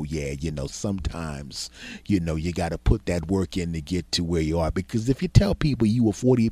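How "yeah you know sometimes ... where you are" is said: soft, falsely modest quote; mock-modest quiet humble brag. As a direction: play it down softly, as if it were nothing. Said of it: "And I'm gonna go".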